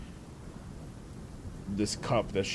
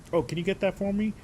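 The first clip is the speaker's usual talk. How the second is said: mock nice voice; 9 semitones higher, faster than usual. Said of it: "switches to a nice voice on a dime".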